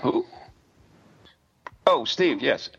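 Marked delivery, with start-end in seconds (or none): none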